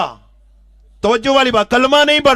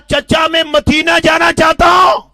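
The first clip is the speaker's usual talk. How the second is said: loud, high and chest out; bold declaration; 6 semitones higher, 4 dB louder than usual. Acted. Youth boldly telling his uncle he will go, loud. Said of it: "سینہ کھول کے کہا".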